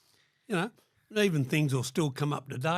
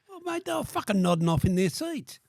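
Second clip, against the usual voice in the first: higher, louder comeback; raised retort; 9 semitones higher, 5 dB louder than usual. Retold or imitated. Answering Rod's complaint, raised retort. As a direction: fire it straight back at him.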